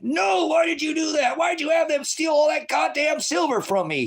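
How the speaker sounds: loud aggrieved shout; shouted protest. Retold or imitated. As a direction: wail out the complaint.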